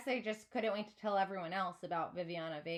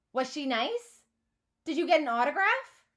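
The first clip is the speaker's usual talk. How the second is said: loud, higher, eager asking; eager questions; 5 semitones higher, 9 dB louder than usual. A narrator's reading. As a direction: gush with excitement. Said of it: "Matthew asks".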